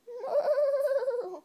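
high, wavering pony neigh; whinny like a pony; playful high-pitched horse imitation. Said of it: "What's a pony say?".

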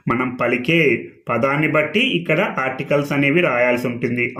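normal talking voice

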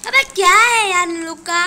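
high, very loud protest; exasperated outburst. Real, not acted. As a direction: explode at being pestered. Fed up with attackers. (झल्लाकर ज़ोर से चिल्लाना)

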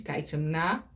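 usual speaking voice

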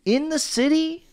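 very high, put-on squeaky voice; mock coy falsetto. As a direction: dodge the question in a hammed-up high voice. Hammed-up coy answer about sex.

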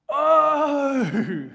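loud, high burst of sudden realization; student's aha. Acted. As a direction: shout it like the penny just dropped.

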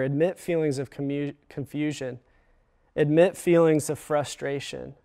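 normal talking voice

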